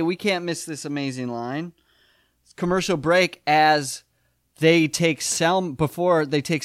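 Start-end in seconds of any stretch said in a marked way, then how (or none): none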